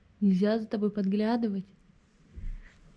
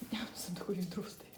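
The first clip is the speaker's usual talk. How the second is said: hushed whisper; 2 semitones lower, 12 dB quieter than usual. Real, not acted. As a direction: whisper it quietly. Unvoiced aside.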